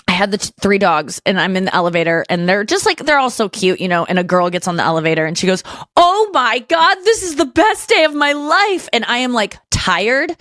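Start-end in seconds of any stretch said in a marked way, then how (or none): 6.0-8.9 high, drawn-out mock squeal of delight; mock gushing. Girl seeing three dogs in the elevator, mock-gushing. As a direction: imitate an over-thrilled dog lover.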